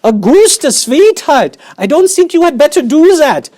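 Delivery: high, loud fussing mother voice; anxious mother. Acted. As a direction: plead with him loudly, all sugar and alarm. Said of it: "shouted Missus Gloop".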